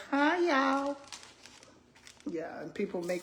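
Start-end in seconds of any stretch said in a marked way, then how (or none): 0.1-1.0 high, bright-eyed "Hi, y'all" in a put-on voice; imitating that girl. Mimicking the mukbang girl greeting her viewers. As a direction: greet like the eager food video girl. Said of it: "She's like".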